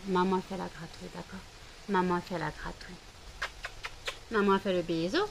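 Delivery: soft cooing, fading very quiet; soothing pet. Real, not acted. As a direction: hush the dogs tenderly. Soothing dogs during thunder.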